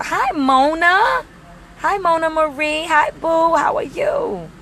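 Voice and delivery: high, sing-song hellos to fans; light up greeting each friend; excited greeting. Real, not acted. Greeting live viewers by name, excited and high.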